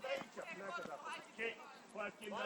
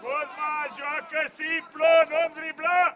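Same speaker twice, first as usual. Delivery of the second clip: raised pitch, growing louder to the end; shouted order; 5 semitones higher, 8 dB louder than usual. Real, not acted. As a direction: shout it insistently across the pitch. Coach shouting at player. (strigăt de antrenor, înalt, tot mai tare)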